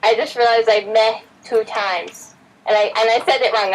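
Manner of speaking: ordinary talk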